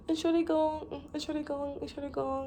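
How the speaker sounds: high, soft, repeated polite apology. Retold or imitated. Acting out apologising to strangers in German, soft apology.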